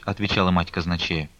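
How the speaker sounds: ordinary delivery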